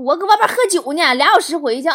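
high, loud phone reply; loud offhand husband. Acted. Husband out drinking answers his wife's call, loud and offhand.

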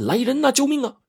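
hammed-up distant scream for rescue; desperate cry for help. A narrator's reading. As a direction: cry out high, like someone dangling. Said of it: "拼命的大喊".

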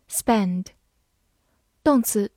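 ordinary talk

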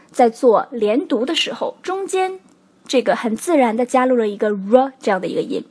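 ordinary delivery

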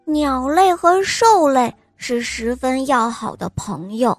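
ordinary delivery

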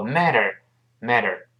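"matter" run together with a soft d-like t. Shown as a demonstration. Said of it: "读快之后".

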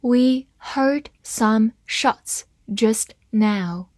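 slow, careful read-aloud. Shown as a demonstration. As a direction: read it slowly for the class. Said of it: "好，慢慢来读". (慢速朗读)

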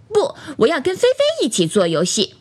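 put-on high childish protest; refusing doll. A narrator's reading. Doll turns down bear's game for Feifei, high childish refusal. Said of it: "弟弟说".

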